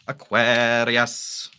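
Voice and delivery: slow, showy naming; drawn-out announcement. Real, not acted. Reader introducing the next sign, drawn-out and slow. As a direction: stretch the sign's name out slowly like an announcer.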